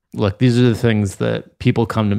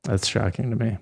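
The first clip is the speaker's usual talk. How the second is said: sarcastic whisper back; mock-shocked whisper; 8 dB quieter than usual. Teasing the co-host about the hot captain, mock whisper.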